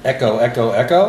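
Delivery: echoing voice; echo effect. Pretend. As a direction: call down a well. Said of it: "that echo is crazy".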